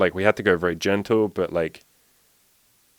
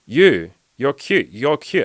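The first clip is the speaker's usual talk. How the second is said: slow over-explaining; 5 semitones higher, 4 dB louder, slower than usual. Retold or imitated. Explaining slowly to her.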